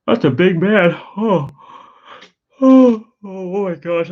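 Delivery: words stretched high through a big yawn; real sleepy yawn over the words. Real, not acted. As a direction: talk right through a yawn. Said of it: "I apologize for yawning".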